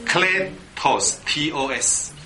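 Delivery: "Kletos" in slow parts, then "T O S" by letter; spelling out. Shown as a demonstration. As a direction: dictate "Kle-tos, T O S" very slowly. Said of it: "罗马拼音的话".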